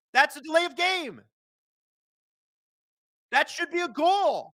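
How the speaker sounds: high, loud, outraged yelling at the refs; heated shouting. Real, not acted. Streamer yelling at the broadcast over a missed penalty, heated shouting. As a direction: blow up over the pushed-off net, loud and high. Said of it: "Sorry, I got heated there".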